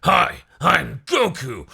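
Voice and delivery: slow, drawn-out greeting, stretched; powered-up Goku greeting. Retold or imitated. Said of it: "you hear Schemmel go through".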